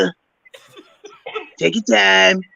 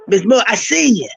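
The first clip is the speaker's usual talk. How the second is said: imitating Mister Brown; 6 semitones higher than usual. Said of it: "I said".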